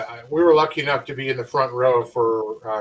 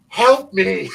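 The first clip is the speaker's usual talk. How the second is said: mock cry for help, raised a bit; louder, higher imitation of a desperate plea; retold plea for help; 6 semitones higher, 6 dB louder than usual. Retelling a wrestler's plea to his wife, mock cry. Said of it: "Kenzie's screaming at my wife".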